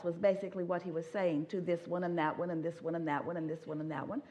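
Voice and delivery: ordinary talk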